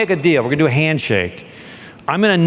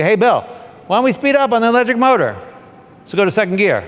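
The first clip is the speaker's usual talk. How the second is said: eager order; 6 semitones higher, faster than usual. Retold or imitated. Captain retells giving order.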